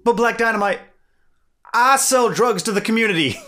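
high, loud baffled protest; protesting pimp. Retold or imitated. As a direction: whine it like a caught pimp. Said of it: "he goes".

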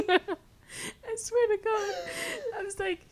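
high, slow laughing speech. Real, not acted. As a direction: talk through laughter. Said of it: "laughing through the story".

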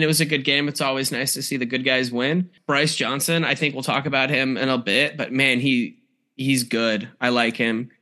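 normal talking voice

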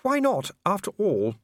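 a noble lady musing aloud; Lady Sybil. A narrator's reading.

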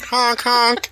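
high honking clown horn; silly clown. Pretend. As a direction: honk like a tiny clown car. Shriners in little clown cars.

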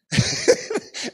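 brief, high breathy chuckle; short chuckle. Real, not acted. Preacher amused partway through his testimony, short chuckle. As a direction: let a little laugh slip out.

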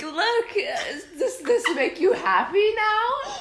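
very high, loud voice through laughter; laughing imitation. Boyfriend showing fan art to cheer girlfriend, laughing imitation. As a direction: mimic him while cracking up.

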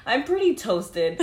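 slow, giggling through each word; drawn-out laughing confession; slow laughing admission. Retold or imitated. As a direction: confess it while cracking up. Confessing to boss while giggling.

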